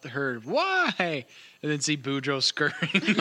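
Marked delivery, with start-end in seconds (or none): 0.4-1.2 imitated distant yell. Game master retells what everybody heard, mild high mock yell. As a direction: mimic a far-off yell at normal volume. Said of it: "Everybody kinda heard all".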